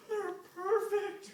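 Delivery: high, slow, awed satyr near tears; weeping with joy. Acted. Grover weeping with joy at the singers' chord. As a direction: Grover overcome by beautiful music. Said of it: "Grover was weeping with joy".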